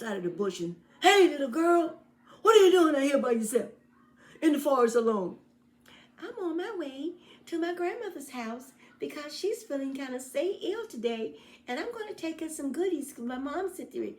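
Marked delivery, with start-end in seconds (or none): 1.0-5.4 high, loud, fast sly wolf calling out; a loud, high wolf luring the girl. Acted. Wolf greets girl in forest. 6.2-14.1 put-on high, sweet little-girl voice; a high, innocent little girl explaining. Girl answers wolf.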